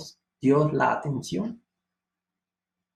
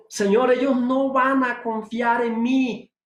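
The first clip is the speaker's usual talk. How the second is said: higher, slow whiny plea; pleading Moses; 7 semitones higher than usual. Acted. Preacher voicing Moses to the Lord, slow high plea. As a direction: beg like an unworthy man.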